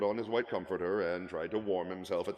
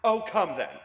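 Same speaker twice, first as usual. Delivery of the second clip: high, loud cheerful coaxing; gaily; 11 semitones higher, 12 dB louder than usual. Acted. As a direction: brush it aside merrily. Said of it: "returned the nephew gaily".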